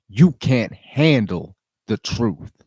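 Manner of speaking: slow, loud, hard retort from a courtroom witness; a lightly acted witness-stand comeback, slow and loud; gangster movie retort. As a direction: lay it down slow like the famous movie line. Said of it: "He retorts with the most gangster shit".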